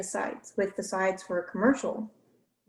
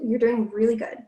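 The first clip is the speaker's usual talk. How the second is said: ordinary talk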